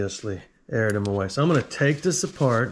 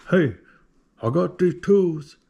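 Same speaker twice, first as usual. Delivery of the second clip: a hint of a hillbilly twang, slower and louder; hillbilly accent; 6 semitones higher, 4 dB louder than usual. Recounting the email to Snap-on in a louder, slower hillbilly twang. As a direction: lean into a Southern drawl on tools. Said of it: "there was no accent in the emails".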